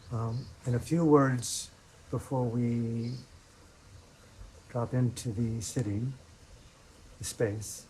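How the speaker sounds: soft, unhurried meditation voice with long pauses; guided meditation. Acted. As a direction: ease them into sitting, quietly, leaving space between phrases.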